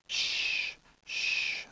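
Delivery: whispered shh, barely a breath; hushing whisper. Acted. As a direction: hush him urgently. Tree hushes the loud bear.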